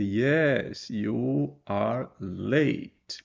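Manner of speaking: "Yes, you are late" dragged out very slowly; overlong answer, dragged. Shown as a demonstration. Teacher showing the too-long answer, very slowly. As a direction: drag out the unnecessary full answer. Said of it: "Você não precisa dizer".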